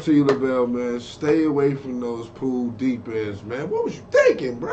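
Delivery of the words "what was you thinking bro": much higher, incredulous teasing question; mock disbelief. Real, not acted. Host teasing the comedian in mock disbelief.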